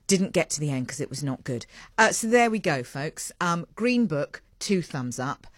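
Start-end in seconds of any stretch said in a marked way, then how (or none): none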